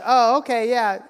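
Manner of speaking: high, loud, quick polite deflection; awkward brush-off. Retold or imitated. [name] dodging the Cats comment, quick and high. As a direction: rush past the awkward moment. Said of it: "then she was like".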